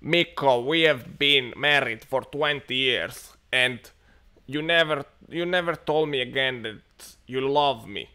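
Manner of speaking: loud, slow Finnish wife nagging; a complaining wife with a Finnish accent. Retold or imitated. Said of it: "the lady goes like".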